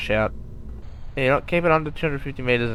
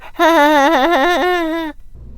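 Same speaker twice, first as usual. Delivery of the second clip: high, loud burst of laughter; squeaky giggle; 14 semitones higher, 5 dB louder than usual. Real, not acted. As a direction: crack up at your own joke. Laughing at own joke.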